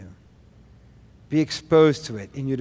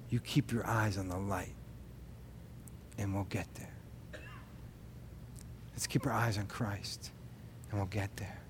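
hushed reassurance; 7 semitones lower, 17 dB quieter than usual. Retold or imitated. Father soothing child in dark.

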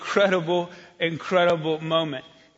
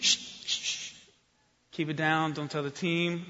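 softer, secretive shh and keep it down; hushed shushing; 3 semitones lower, 9 dB quieter than usual. Retold or imitated. Guide hushing the pastors so the team won't hear, softer.

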